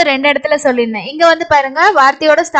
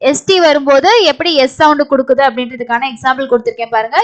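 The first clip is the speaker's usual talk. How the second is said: ordinary talk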